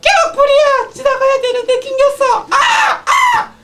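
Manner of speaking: very high panicked screaming; alarm shout. Acted. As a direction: scream for help, trapped in a crowd. Panic in fire scene.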